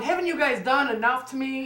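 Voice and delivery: high, pleading exasperation; worn out, begging them to quit; exasperated retort. Retold or imitated. To cops at 3am after a long night, exasperated.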